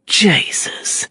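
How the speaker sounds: breathy disbelieving whispered oath; whispered curse. A narrator's reading. Prisoner cursing under his breath at the deal. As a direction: hiss it in disbelief. Gentry's disgusted outburst.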